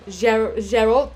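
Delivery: halting, poorly faked French "Gerald"; attempted French accent. Shown as a demonstration. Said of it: "I can't do a French accent".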